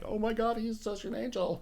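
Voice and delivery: a teasing impression of an adoring fan; gushing fan. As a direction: swoon over an angelic voice, half-joking. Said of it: "my voice isn't like".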